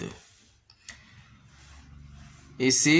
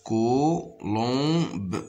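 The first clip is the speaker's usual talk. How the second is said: "Colombe" in three separated beats; long syllables with gaps in "Colombe". Shown as a demonstration. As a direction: sound out "Colombe" as co, lom, be. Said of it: "Ici, la même chose".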